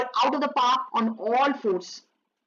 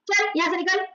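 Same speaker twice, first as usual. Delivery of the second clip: dismissive order; 7 semitones higher than usual. Acted. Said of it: "और क्या बोलते?".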